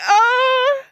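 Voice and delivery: high, loud crying wail; sobbing oh. Real, not acted. Overwhelmed by love for friends, crying. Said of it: "I'm gonna cry more".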